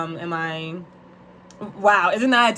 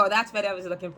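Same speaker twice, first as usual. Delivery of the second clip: ordinary talk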